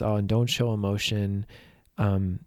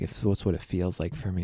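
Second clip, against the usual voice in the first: ordinary talk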